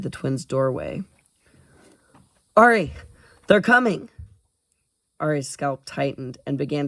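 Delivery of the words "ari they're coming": loud, high alarm; urgent warning. Acted. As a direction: rush in and warn her. Boy sliding in, warning.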